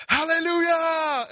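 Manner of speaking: very high, loud, long-held cry; sustained ringing mock praise shout; preacher's hallelujah shout. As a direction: imitate a preacher whooping up the church. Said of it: "And then my friend goes".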